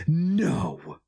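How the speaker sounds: high desperate cry of denial. A narrator's reading. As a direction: throw the word out in alarm. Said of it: "Sheo Narnra heard himself cry".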